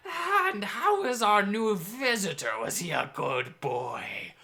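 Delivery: high, slow, cooing hag voice; sea hag. Acted. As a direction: coo it out like a sly sea witch. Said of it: "the hag and the flesh golem had a great little piece of dialogue".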